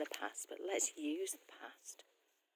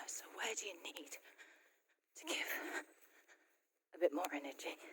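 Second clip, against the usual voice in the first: raised, energised coaching voice; more energy; 2 semitones higher, 5 dB louder than usual. Real, not acted. Coach asking viewers where to give energy, more energy. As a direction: lift it and push the question out louder. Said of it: "put a bit more energy into this one".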